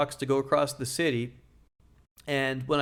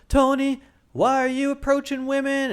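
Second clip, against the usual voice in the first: much higher, whiny mock sob; imitating crying girlfriend; 11 semitones higher, 4 dB louder than usual. Upset girlfriend confronting Tony about his blog, mock-crying. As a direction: squeak it out like a tearful girlfriend. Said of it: "The tears started rolling".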